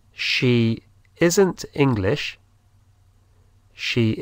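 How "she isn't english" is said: She isn't English said slowly; slow, clear dictation pace; slow model. Shown as a demonstration. Teacher repeats slowly for learners. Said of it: "Ella no es inglesa".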